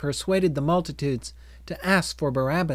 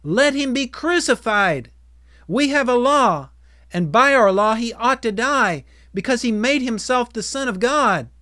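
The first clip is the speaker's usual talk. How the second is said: high, raised accusing cry; crying out exceedingly; 8 semitones higher, 5 dB louder than usual. A narrator's reading. Crowd argues their law to Pilate, crying out. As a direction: cry out the charge as an insistent crowd.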